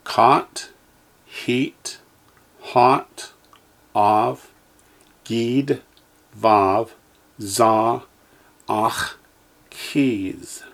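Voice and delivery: each syllable said slowly and clearly, with a pause after it; teacher's careful drill voice; Hebrew syllables for echoing. Shown as a demonstration. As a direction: model every Hebrew sound for the class to repeat. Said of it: "Echo me, please".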